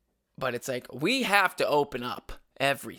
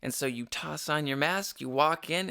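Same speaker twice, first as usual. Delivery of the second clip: ordinary talk, nothing marked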